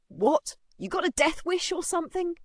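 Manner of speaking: high, challenging bully's question; colder threat. A narrator's reading. Bully threatens the boy who dodged, challenging. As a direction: threaten him with a rising challenge. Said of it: "His voice dropped colder as he added".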